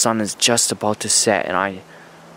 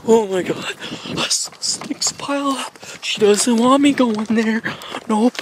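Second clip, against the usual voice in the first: panicked; 9 semitones higher than usual. Acted. Panicked in woods.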